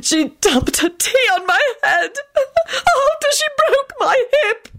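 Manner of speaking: high, slow, wailing sob; hammed-up tearful old lady; sobbing. A narrator's reading. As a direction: wail the complaint through sobs. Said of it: "began sobbing again".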